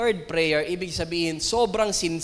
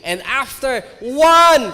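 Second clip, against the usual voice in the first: slow emphatic shout; 4 semitones higher, 9 dB louder, slower than usual. Acted. Preacher stressing the number one.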